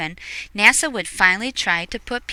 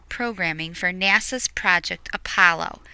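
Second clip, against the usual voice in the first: ordinary delivery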